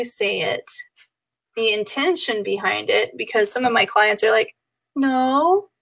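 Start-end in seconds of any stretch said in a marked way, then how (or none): 4.9-5.7 no with a rising, unsure tune; questioning no. Shown as a demonstration. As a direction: say no like you're asking. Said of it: "There's a question mark at the end of that sentence".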